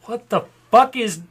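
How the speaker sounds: high, loud, drawn-out disbelief; baffled outburst. Retold or imitated. Retelling his find of an odd beer can, baffled outburst. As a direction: exclaim it slowly, pitch leaping up.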